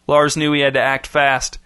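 usual speaking voice

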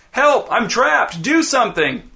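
high, urgent cries for help; desperate calls. A narrator's reading. Trapped Chip begs Blaine for help, urgent high cries.

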